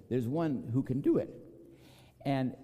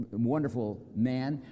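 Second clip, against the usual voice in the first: usual speaking voice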